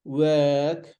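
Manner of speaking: "Work" drawn out, no r sounded; long vowel. Shown as a demonstration. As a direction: Work stretched on a central 'er'. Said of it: "This colon shows that it is long".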